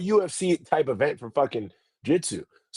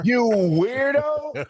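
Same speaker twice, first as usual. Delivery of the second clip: long, drawn-out high jab; mock indignant; 8 semitones higher, much slower than usual. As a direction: stretch it out in mock exasperation. Retold exasperated confrontation in the locker room.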